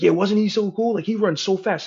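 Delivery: high, loud gushing fan; imitated excited fan. Sports fan agreeing about a player, mock excitement. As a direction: gush like a thrilled sports fan, high-pitched. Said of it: "they're, like, so happy".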